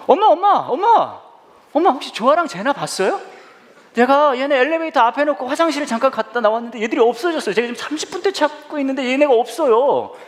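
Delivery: high-pitched, frantic son act; feigned panic. Acted. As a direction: run up calling for mom in put-on alarm. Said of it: "표정을 조금 연기를 해야 되니까".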